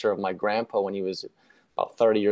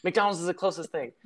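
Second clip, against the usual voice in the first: imitating dad, pitched much higher; squeaky, hurried dad in a panic, played for laughs; mock panicked dad; 9 semitones higher, faster than usual. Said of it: "was like".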